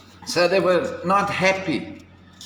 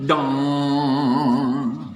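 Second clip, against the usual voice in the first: clock dong; 3 semitones lower than usual. Acted. Clock striking in the story.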